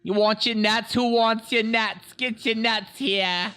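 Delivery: high, slow ballpark vendor call; vendor accent. Pretend. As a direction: hawk nuts like a stadium seller. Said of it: "that's my vendor accent".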